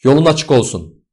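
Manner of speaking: high, loud send-off; shouted farewell. A narrator's reading. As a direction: call good wishes after someone leaving. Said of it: "diye bağırıyorlardı".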